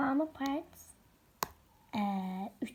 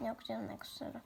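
ordinary talk, nothing marked

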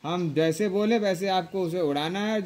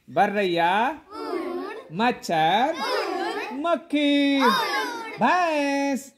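calling out; 7 semitones higher, much slower than usual. Real, not acted. Teacher calls out game words.

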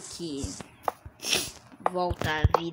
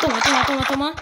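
higher, louder battle cry; excited attack cries; 6 semitones higher, 6 dB louder, much faster than usual. Real, not acted. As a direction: shout each hit. Attacking boss in game. (gritos de ataque)

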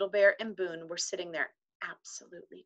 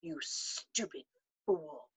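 much softer, slow, bitter; low voice; 8 dB quieter, much slower than usual. A narrator's reading. Omri to Patrick, a low bitter insult. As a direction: spit it out under your breath. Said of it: "said to Patrick in a low voice".

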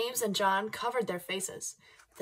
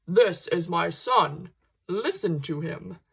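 slow, booming voice of God, louder; 3 semitones lower, 6 dB louder, slower than usual. Acted. Reading God's words from the cloud to children, slow and booming. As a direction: boom it out slowly from the cloud. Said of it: "I'm gonna read this in my God voice".

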